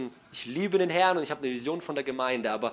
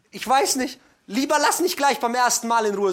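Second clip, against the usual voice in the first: normal talking voice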